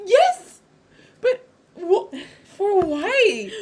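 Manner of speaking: high, loud indignant cry, laughing at the end; can't believe it, squeal it out; shrieking outrage. Real, not acted. To her friend about the changed setting, shrieking in outrage.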